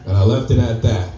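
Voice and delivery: ordinary talk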